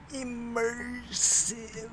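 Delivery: "Immersive" stretched very high and slow; exaggerated. Shown as a demonstration. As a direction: sing out "Immersive" high and long. Repeats word, then exaggerates it.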